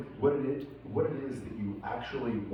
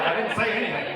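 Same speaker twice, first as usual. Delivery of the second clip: high, innocent protest, acting like nothing was said; mock innocent denial; 7 semitones higher, 4 dB louder than usual. Speaker mocks himself after rambling on, mock innocent. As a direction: play dumb about the rambling. Rambling, then denies saying anything.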